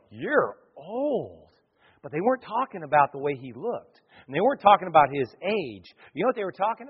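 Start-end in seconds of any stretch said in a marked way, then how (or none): 0.1-1.5 softer, drawn-out judgement; people's verdict on Samuel. Acted. People coming to Samuel, soft drawn-out verdict. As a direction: say it softly, letting it hang. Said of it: "they go".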